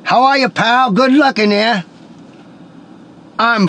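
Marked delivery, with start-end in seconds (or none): 0.0-1.8 higher-pitched comedian impression; put-on, pitched-up comic voice; Buddy Hackett. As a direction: imitate an East Coast comic greeting a pal. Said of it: "Buddy Hackett walks out of the room".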